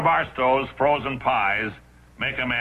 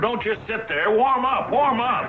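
excited; 11 semitones higher, slower than usual. Acted. Urging the TV set to warm up.

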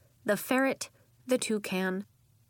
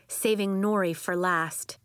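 plain reading voice

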